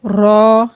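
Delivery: रौ drawn out, heavy, with an o colour; full-mouth ro. Shown as a demonstration. Teacher models the Arabic letter, heavy रौ. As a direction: say रौ thick and full. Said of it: "इसको पुर पढ़ना है".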